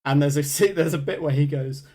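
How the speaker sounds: usual speaking voice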